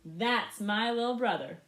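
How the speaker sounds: loud, slow, proud call; proud brother calling out; proud big brother. Acted. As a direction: brag about him to the crowd. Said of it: "he proudly said".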